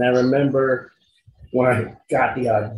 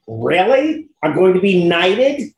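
rising, high-pitched disbelief; incredulous; 6 semitones higher than usual. Retold or imitated. Actor reacting to needing Aaron Spelling's approval, incredulous. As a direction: build from disbelief to a high squeaked "what". Acting out his astonished reaction.

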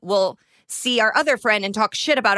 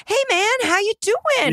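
high, falsely cheery greeting; fake friendly; 12 semitones higher than usual. To the person you screwed over, high fake-cheery greeting. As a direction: act chummy to cover guilt.

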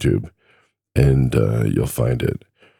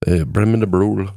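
higher, put-on Scottish accent; 6 semitones higher than usual. Shown as a demonstration. Trucker trying out his Scottish accent for listeners, playful attempt. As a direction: have a go at an amateur Scottish accent. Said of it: "work on my Scottish accent".

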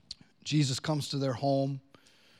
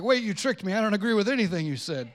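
mock protest; 7 semitones higher, 4 dB louder, faster than usual.

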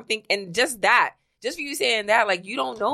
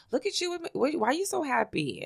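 lightly imitated coworker, soft, lifting high at first; mimicked nosy coworker; 6 semitones higher, 7 dB quieter than usual. Retelling a messy coworker's remark at work, lightly mimicked. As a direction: quietly mimic a prying coworker.

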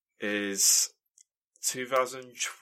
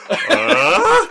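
startled squeak; 16 dB louder than usual. Retold or imitated. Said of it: "You could just see me go".

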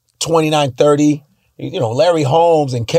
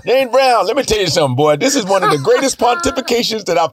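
much higher, shouted rambling sermon; 10 semitones higher than usual. Pretend. Singer hyping the fight, high and shouting. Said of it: "He's like".

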